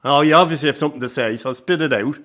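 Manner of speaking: irritated demand. Acted. Grandfather confronting son's hint.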